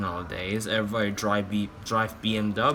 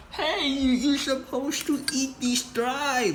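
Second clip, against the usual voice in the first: very high squeaky mock voice, slow at the end; mocking critic voice. Youtuber mocking commenters in a high squeaky voice. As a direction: mimic a nagging commenter in a shrill falsetto.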